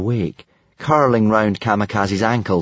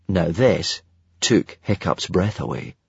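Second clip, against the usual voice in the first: ordinary delivery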